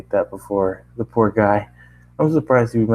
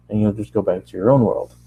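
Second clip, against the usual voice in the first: usual speaking voice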